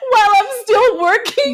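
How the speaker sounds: can barely get it out for laughing; laugh-crying. Real, not acted. Laughing while retelling absurd request.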